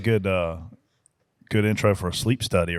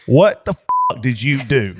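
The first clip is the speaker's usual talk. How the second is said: angry; 5 semitones higher, 6 dB louder, slower than usual. Retold or imitated. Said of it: "He's like".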